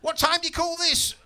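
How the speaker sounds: high, loud demanding wife; snappy wife. Retold or imitated. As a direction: scold him like a woken wife. Wife confronting husband.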